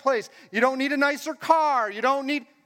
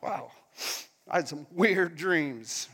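just-woken man; 6 semitones lower, 5 dB quieter, slower than usual. Acted.

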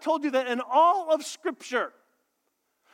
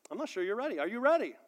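ordinary talk

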